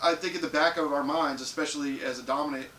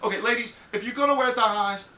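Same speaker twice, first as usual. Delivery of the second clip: ordinary talk, nothing marked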